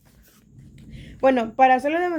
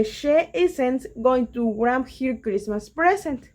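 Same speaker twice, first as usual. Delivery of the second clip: slow English example read; much slower than usual. Shown as a demonstration. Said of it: "Sería esta".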